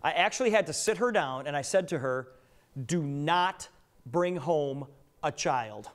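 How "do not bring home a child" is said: very slow, spaced-out command; stern warning. Retold or imitated. Father warning his daughter before her trip, slow stern warning. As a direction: lay down the law word by word.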